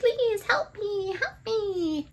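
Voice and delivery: very high, drawn-out ghostly plea; slow falsetto "save me" voice; pleading ghost. Acted. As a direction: beg for help in a tiny, high wail.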